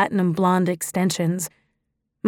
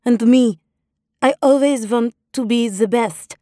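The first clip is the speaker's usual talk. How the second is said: put-on higher, slow Russian accent with broken grammar; 4 semitones higher than usual. Disguised woman telling the club owner her fake past, in a Russian accent. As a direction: sell the immigrant backstory in a Russian accent. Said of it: "my affected Russian accent".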